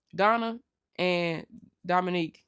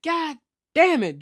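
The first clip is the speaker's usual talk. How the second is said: loud, high burst of frustration; a retold father swearing in disbelief; exasperated curse; 8 semitones higher, 8 dB louder than usual. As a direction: blow up at Junior. L C reacting to the truck.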